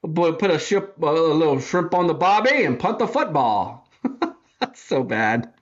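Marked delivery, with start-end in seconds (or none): 0.0-3.9 a hammed-up, lightly done Australian accent; mock Aussie twang, badly faked. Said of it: "that awful Australian accent".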